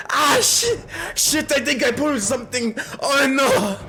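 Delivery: high-pitched hammed-up wailing; screaming in pain. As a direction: cry out over a sudden injury. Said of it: "He is screaming for pain".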